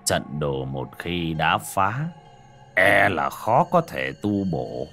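slow, grave elder; old master voice. Acted. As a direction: speak like a weary old sage. Old Taoist master speaks.

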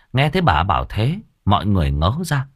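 ordinary delivery, nothing marked

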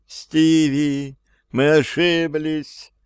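high, slow desperate cry. A narrator's reading. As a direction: call out in despair. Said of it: "отчаянный голос Эммы".

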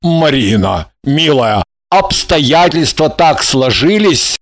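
low, halting appeal; uncertain, slowing male apology; hesitant. A narrator's reading. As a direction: begin an excuse unsurely, pitched low at first. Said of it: "неуверенно начал он".

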